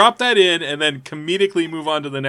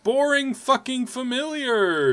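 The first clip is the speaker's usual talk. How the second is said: higher, slow ghostly wail; 6 semitones higher, slower than usual. Acted. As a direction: wail it out slowly like a spirit. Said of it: "I dropped out of ghost voice".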